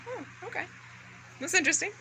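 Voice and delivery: small, high, quiet reaction; retold bemused reply. Acts own bemused reply to dad.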